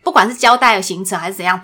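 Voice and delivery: usual speaking voice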